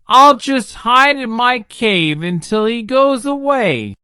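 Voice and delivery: a timid dragon hoping to hide; dragon's voice. A narrator's reading. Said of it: "said the dragon".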